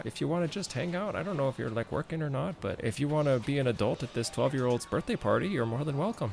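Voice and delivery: put-on high, lilting hostess voice with a southern accent; friendly southern mom. Jimmy's mom inviting the worker to stay, friendly southern accent.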